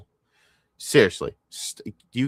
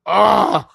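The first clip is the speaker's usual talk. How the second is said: loud, high yell of frustration; high exasperated outburst; 11 semitones higher, 11 dB louder than usual. Real, not acted. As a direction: let out a frustrated "aah".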